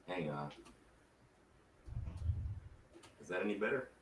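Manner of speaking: muffled, like from another room; faint voice from behind a wall; through a wall. Real, not acted. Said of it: "It's like talking through walls".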